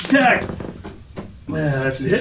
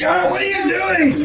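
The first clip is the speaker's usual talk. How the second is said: alarmed cry. Retold or imitated. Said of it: "You're like".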